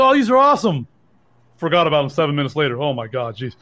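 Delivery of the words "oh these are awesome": breezy retold remark. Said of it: "Was like".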